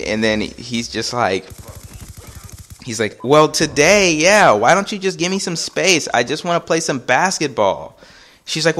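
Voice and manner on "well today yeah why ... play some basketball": raised, higher-pitched pleading retort; exasperated protest. Retold or imitated. Steve asks Rebecca for some space, exasperated. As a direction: push back, begging for room. Boyfriend argues back, retold.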